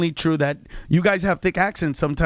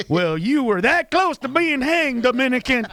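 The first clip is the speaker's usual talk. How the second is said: high mock Klansman; 5 semitones higher than usual. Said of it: "they'd be like".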